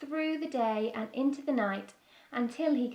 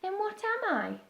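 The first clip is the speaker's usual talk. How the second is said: small bird voice; 9 semitones higher than usual. Acted. Beaky voiced in story.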